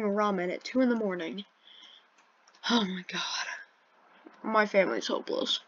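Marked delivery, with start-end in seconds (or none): 2.7-3.5 exasperated whispered sigh of words. Real, not acted. Teen vlogger whispering about his dad's late ramen. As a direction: whisper it in disbelief. Dad microwaving ramen at night.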